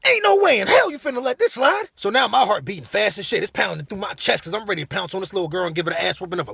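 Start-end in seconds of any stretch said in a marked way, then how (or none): 0.1-1.9 high, loud protest; loud high-pitched disbelief; acted outrage. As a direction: egg them on in high indignation.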